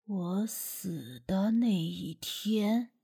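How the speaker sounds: low, slow, hushed and heavy; a ghost speaking through a boy, very slow; spirit-possessed voice. Acted. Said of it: "鬼仙上身时说话的口气".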